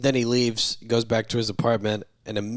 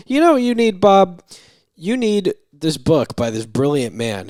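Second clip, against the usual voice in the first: much higher, loud voicing of a doctor's pitch; eager doctor imitation; 7 semitones higher, 8 dB louder than usual. Doctor recommends his own book to his patient, acted-out eager pitch. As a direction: act out a doctor proudly prescribing.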